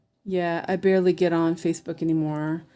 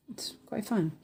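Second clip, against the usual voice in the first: whisper; 4 semitones higher, 7 dB quieter than usual. Real, not acted. Aside whispered to viewers.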